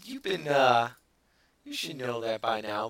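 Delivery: ordinary talk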